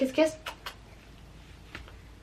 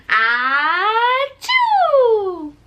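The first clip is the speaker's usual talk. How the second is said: high playful fake sneeze "Ah, choo"; squeaky pretend sneeze for baby; mock sneeze; 11 semitones higher, 5 dB louder than usual. Shown as a demonstration.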